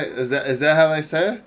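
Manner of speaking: ordinary delivery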